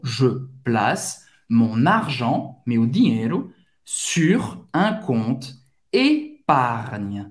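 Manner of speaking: je place mon argent sur un compte épargne, each word slow and clear; careful slow French; slow French model sentence. Shown as a demonstration. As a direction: say it slowly word by word. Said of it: "Pra fazer esse G N".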